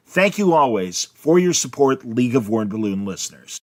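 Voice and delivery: polished podcast promo read. Acted. Host asking listeners to join the League, ad read. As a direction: pitch it to listeners in an announcer's delivery.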